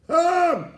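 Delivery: a sudden high shriek of laughter; loud laughing shriek. Real, not acted. Mocking preacher shrieks with laughter at the scandal.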